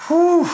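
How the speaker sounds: high, sustained exhaled "whew"; long high "whoo" of awe; awed whew. Retold or imitated. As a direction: let out a high whistling whew of amazement. Awed reaction at baptism.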